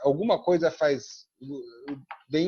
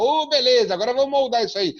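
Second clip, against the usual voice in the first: pleased dentist; 10 semitones higher, 7 dB louder, faster than usual. Retold or imitated. Dentist pleased at clean preparation.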